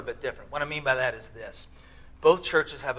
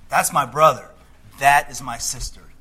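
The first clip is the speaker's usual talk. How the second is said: louder, proudly claiming them; proud declaration; 9 dB louder than usual. Pretend. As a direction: announce it loud and unashamed. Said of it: "he doesn't do it in shame".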